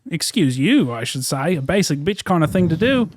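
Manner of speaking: put-on Aussie accent, held steady; Australian accent. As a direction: fake Australian accent in character. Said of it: "continued the Australian accent".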